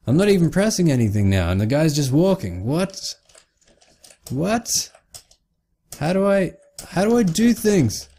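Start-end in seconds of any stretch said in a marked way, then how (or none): 2.7-8.1 high-pitched, incredulous squeak; bewildered high whine; baffled. Real, not acted. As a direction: ask in helpless disbelief, voice shooting up. Baffled that nothing works.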